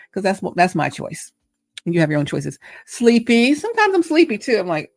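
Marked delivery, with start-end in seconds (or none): none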